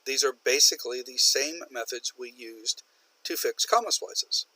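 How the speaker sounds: ordinary talk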